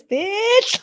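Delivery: a sudden high squeal of cocky glee; pumped-up swagger. Retold or imitated. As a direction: toss it off, giddy with power. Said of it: "Feeling really fucking powerful".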